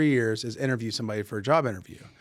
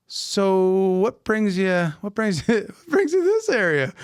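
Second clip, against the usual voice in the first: high, loud question broken by laughter; amused probing; 7 semitones higher, 4 dB louder, slower than usual. Retold or imitated. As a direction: act out an incredulous question, laughing through it. Acting his puzzled interview question.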